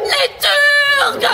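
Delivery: Les Turcs hurlé, haut et fort; cri d'alarme. Acted. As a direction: donner l'alarme à pleine voix. Alarme criée sur scène. (un personnage crie l'arrivée des Turcs)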